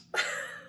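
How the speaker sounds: soft breathy sob that turns into a laugh; a real laugh through tears; tearful laugh-sob. Real, not acted. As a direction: let a sob slip out as a laugh. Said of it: "I'm crying so much".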